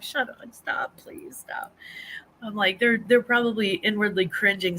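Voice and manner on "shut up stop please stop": whisper. Retold or imitated. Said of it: "And I'm like".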